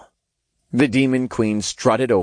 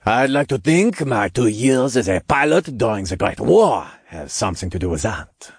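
put-on French accent. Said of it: "thick French accent".

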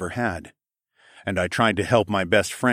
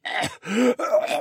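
wet choking cough; bloody cough; 13 semitones higher than usual. Acted. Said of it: "croaked out as strings of blood".

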